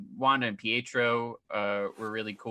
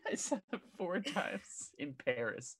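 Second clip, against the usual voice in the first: laughing; 9 semitones higher, 14 dB louder than usual. Real, not acted. Laughing admission.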